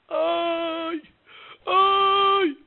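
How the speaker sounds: long, high wail of "oy"; drawn-out mock lament; imitated wailing. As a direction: mimic someone wailing in the distance, high and sustained. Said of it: "ואני שומע בכי נורא".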